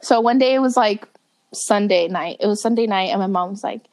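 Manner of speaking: normal talking voice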